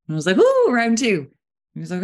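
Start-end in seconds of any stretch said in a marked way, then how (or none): 0.4-1.3 eager. Retold or imitated. Eager for more pancakes.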